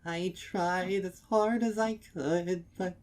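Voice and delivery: high, loud tearful plea breaking into a wail; building to a wail. Acted. Mare confessing the accident to the stallion, breaking into a wail. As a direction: hold it together, then wail the last word. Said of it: "The last word comes out as a long wail".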